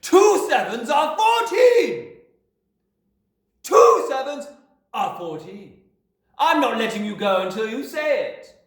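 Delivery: very loud, high, slow shout; bellowing. A narrator's reading. Headmistress holding a boy aloft, bellowing. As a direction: roar the answer at him. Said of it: "Miss Trunchbull bellowed".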